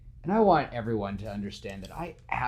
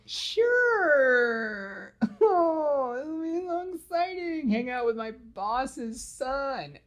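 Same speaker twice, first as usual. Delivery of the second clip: much higher, slow sarcastic gush; sassy Gwen; 3 dB louder, much slower than usual. Retold or imitated. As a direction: drip sarcasm like a sassy heroine. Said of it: "sass Emma Stone would give".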